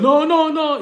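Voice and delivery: high, loud "no, no, no" bawled out; retold bawling, high and loud; shouted refusal. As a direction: bawl it out like a caller protesting. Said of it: "say him ball out".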